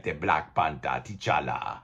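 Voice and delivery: low, soft Wakandan-accent impression. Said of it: "that was my accent I used as Wakanda".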